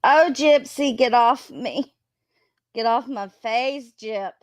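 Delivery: startled loud scold at a pet; scolding the dog. Real, not acted. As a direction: push the dog off, laughing-annoyed, loud. Dog jumping on her face.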